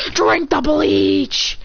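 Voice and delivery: drawn-out high cartoon order; acted command. Boy ordering his rival after the kiss, drawn-out cry.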